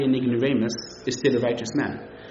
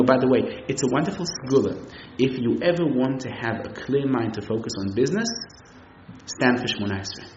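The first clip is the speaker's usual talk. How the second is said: ordinary talk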